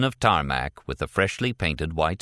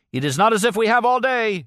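much higher and louder bodyguard shout; 5 dB louder than usual. A narrator's reading. Bodyguard shouts at the hesitating driver over the engine. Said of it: "he shouted above the bedlam".

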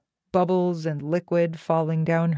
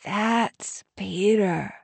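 slow, drawn-out announcement into an ear; stage-loud confiding, stretched out; loudly into an ear; 2 semitones higher, much slower than usual. A narrator's reading. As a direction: say it slowly into her ear. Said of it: "She said loudly into Kelly's ear".

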